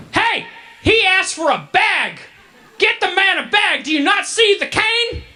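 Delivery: put-on loud, high, laughing Southern shout of an old man; hammed-up Southern gent yelling for the bag, half laughing; mock Southern yell. Old Memphis man yelling at Wendy's staff, mock Southern shout. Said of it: "he's using a really heavy Southern accent".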